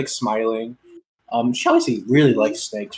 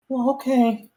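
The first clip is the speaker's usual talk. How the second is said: put-on girl's voice; 7 semitones higher than usual. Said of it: "and she's like".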